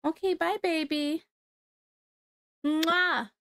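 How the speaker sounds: high, sweet goodbye with a kiss. Real, not acted. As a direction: blow the little one a kiss. Saying goodbye to her toddler.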